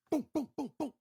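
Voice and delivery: high, quick booms; vocal gunfire effect; imitated gunshots. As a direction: punch out a run of gunshot sounds.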